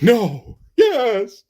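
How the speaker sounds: high, loud theatrical cry; hammed-up cry rising to a high yes; mock horror. Mock horror at plague news.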